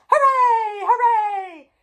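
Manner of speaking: high, loud hooray; cheering. A narrator's reading. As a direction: cheer joyfully. Crowd cheering.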